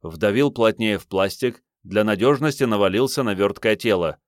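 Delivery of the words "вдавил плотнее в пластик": plain reading voice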